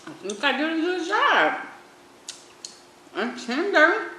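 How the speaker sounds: high, loud proud exclamation; excited. Real, not acted. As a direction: burst out proud of it. Repeats line excitedly.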